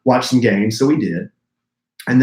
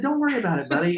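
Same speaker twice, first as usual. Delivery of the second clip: higher, softer soothing voice; tender, quiet reassurance to a kid; reassuring dad; 6 semitones higher, 5 dB quieter, faster than usual. Retold or imitated. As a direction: gently comfort an upset child, pitched up. Dad reassuring upset son.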